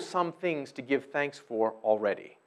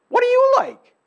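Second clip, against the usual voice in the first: much higher and louder, pressing them; emphatic question; 9 dB louder than usual. Acted. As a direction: demand an answer from the crowd. Rhetorical question to congregation.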